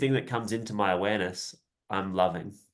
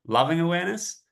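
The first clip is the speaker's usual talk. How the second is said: loving awareness; 7 semitones higher than usual. Shown as a demonstration. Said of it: "Just notice the difference there".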